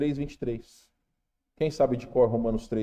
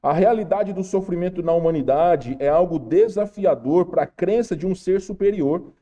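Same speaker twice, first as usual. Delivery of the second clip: ordinary talk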